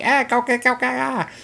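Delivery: high, loud mock panicked yelling; hammed-up high battle screams; imitated soldiers screaming. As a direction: yelp it like soldiers under attack. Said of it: "we hear the little Japs going".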